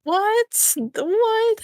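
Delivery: ordinary talk, nothing marked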